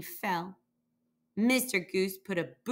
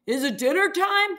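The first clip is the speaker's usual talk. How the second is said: calling out; 8 semitones higher, 4 dB louder than usual. A narrator's reading. Mister Goat calling down to the moose, raised call. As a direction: call it out high and louder. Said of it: "he called".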